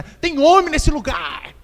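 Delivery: drawn-out, high taunting call; Golias. Acted. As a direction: challenge a whole army, stretching the words. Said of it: "isso aí que é afronta". (desafio afrontoso, agudo e arrastado)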